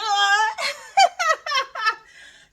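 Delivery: high, loud burst of laughter; big laugh. Real, not acted. After singing to her back, a high loud laugh at herself. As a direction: crack up at your own silliness.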